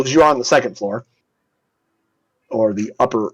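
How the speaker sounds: ordinary talk, nothing marked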